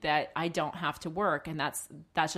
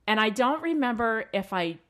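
ordinary talk

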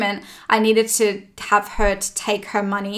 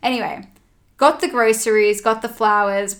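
ordinary talk

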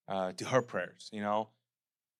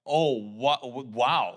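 humbled wow; 5 dB louder, slower than usual. Retold or imitated. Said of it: "so humbled by it".

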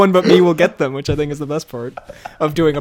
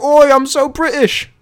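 loud, high, drawn-out British parody; mock British accent; 9 semitones higher, 5 dB louder, slower than usual. Imitating the character's dad in an argument, hammed-up British accent. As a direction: ham up the British accent to win the point. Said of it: "and his dad's like".

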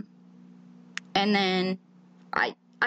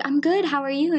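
cheerful reply; 7 semitones higher than usual. Retold or imitated. Retelling her own cheerful reply.

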